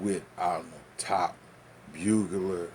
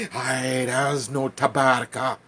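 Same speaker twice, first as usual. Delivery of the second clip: louder, slower taunting reply; mock retort; 4 semitones higher, 9 dB louder, slower than usual. Seller answering the angry customer with a slow taunt. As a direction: drag it out like a sly seller.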